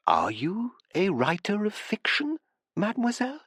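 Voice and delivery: a lightly voiced curious professor; Professor Rigo's voice. A narrator's reading. Rigo asks, higher.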